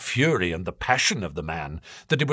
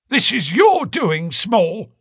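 high, sharp accusing voice; angry police inspector; 11 semitones higher, 4 dB louder than usual. A narrator's reading. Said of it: "said Athelney Jones angrily".

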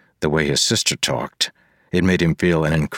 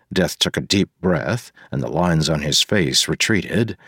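usual speaking voice

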